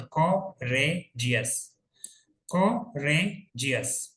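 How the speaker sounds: कोरेजियस very slowly, pausing between को, रे and जियस; teacher sounding out कोरेजियस piece by piece; separate syllables. Shown as a demonstration. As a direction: break कोरेजियस into three spaced parts. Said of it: "three syllable है".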